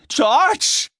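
very high, far-carrying cry; desperate high-pitched call; scream downwards. A narrator's reading. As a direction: scream his name down into the depths. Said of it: "schrie er nach unten".

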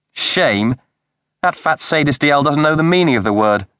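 indignant. A narrator's reading. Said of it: "Ellie put on her indignant look".

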